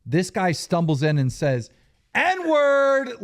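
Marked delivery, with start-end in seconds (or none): 2.1-3.2 high, drawn-out mock holler; imitated drunken outburst. Storyteller imitating drunk friend entering the diner, mock hollering. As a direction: blurt it out high like a drunk stumbling in.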